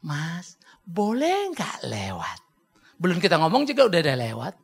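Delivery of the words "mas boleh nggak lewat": soft, slow Javanese request; mock-polite, drawn-out Javanese manner; Javanese politeness. Shown as a demonstration. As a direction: ask timidly, stretching each word like a Javanese. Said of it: "Orang Jawa".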